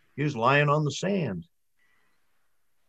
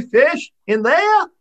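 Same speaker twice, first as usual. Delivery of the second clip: high, loud disbelieving question; can't believe his ears; loud high double take; incredulous; 14 semitones higher, 9 dB louder, slower than usual. Acted. Charlie disbelieving the serpent.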